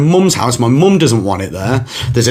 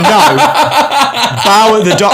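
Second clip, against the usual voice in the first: laughing; 7 semitones higher, 3 dB louder than usual. Real, not acted. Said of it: "And I'm like".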